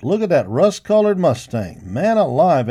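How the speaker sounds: loud, high excited call; excited shout. A narrator's reading. Cowboy admiring the mustang to his pals, loud and excited. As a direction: marvel out loud at the horse. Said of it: "a cowboy shouted".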